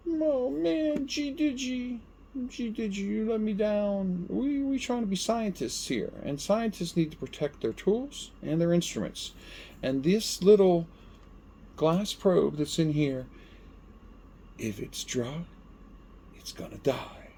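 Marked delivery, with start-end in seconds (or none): 0.1-9.3 disappointed. Real, not acted. Disappointed aside to the product. 14.6-17.3 ominous hush. Acted. Ominous quiet saying.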